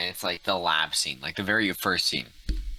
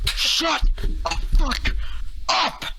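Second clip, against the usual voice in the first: high, loud, drawn-out yell; yelling at the top of his lungs; 5 dB louder, much slower than usual. Retold or imitated. Angry moviegoer yelling at chatty audience, loud drawn-out yell. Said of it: "top of his lungs".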